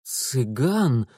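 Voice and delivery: ordinary delivery, nothing marked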